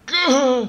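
sudden loud gasp; startled shout. Real, not acted. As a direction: yelp of shock. Falling in game.

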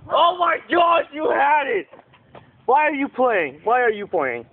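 high shout of disbelief; worked-up high exclamation; excited shout. Real, not acted. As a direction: yell at him for almost having it. Reacting to a near catch.

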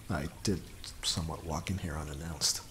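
ordinary talk, nothing marked

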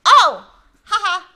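loud, high, embarrassed laugh; nervous laugh. Real, not acted. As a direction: caught off guard, laugh it off. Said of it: "I got so carried away".

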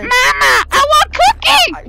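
put-on whiny kid calling mom; yelling across the room. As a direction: call out to mom like a child.

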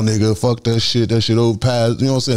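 normal talking voice

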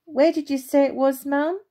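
put-on boy shouting to his mother; calling out. A narrator's reading. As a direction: call out to Mum from afar. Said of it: "Andrew called out".

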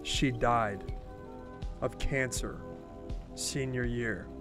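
low, soft, halting disclosure; subdued grief. Acted. Telling old friend her mother died, quiet and pained.

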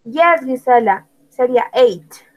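ordinary delivery

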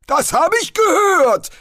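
put-on offended old wizard hitting back; hissed retort. A narrator's reading. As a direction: snap it back as a testy old man. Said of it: "zischte Spolt".

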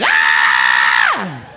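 drawn-out, blaring comic scream; losing-fight scream. Acted. Comic demonstrates how he sounds losing a fight, long scream. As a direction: let out a long, loud wail of defeat. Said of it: "See, I sound like a".

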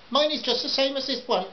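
high, yappy Jack Russell of a woman; high-pitched, snapping customer; snappy housewife. A narrator's reading. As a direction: snap it high like a small terrier. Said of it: "snapped with a voice like a Jack Russell".

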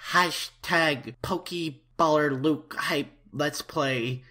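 drawn-out, deliberate hashtag; slow call to action. Shown as a demonstration. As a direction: spell the hashtag out slowly. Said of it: "slow spelled-out hashtag".

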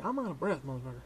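ordinary talk

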